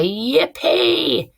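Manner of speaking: high, swooping whoop; excited cheer. Acted. Teacher acting the diary's sailor spotting escape, excited cheer. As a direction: cheer it out, pitch soaring.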